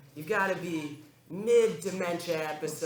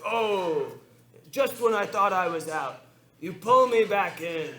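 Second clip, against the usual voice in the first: Al Pacino impression; 4 semitones higher, 5 dB louder than usual. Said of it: "He's like".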